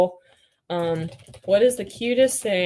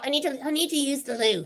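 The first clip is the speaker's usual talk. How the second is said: a higher attempt at a British accent; practising British; 5 semitones higher than usual. Hedgehog puppet practising a British accent, higher. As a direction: try the British line. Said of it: "I'm trying to practice".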